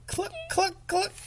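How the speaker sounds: high soft clucking; playful hen clucks; chicken cluck. Acted. Parent acts chicken sound.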